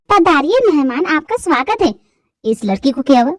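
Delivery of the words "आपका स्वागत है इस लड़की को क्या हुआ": ordinary delivery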